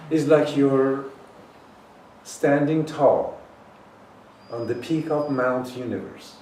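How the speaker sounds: triumphant preacher swell; rousing proclamation. Acted. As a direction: lift the voice to a peak. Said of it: "rousing image, much louder".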